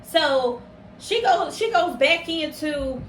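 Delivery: ordinary talk, nothing marked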